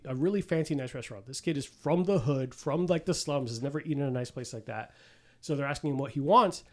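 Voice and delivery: usual speaking voice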